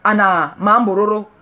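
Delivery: ordinary talk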